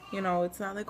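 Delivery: ordinary talk, nothing marked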